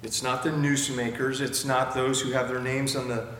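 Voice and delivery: ordinary talk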